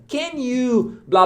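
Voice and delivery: high, drawn-out Can you; slow stretched question. Shown as a demonstration. Teacher shows the question pattern to students, slow and high. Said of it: "você faz uma pergunta".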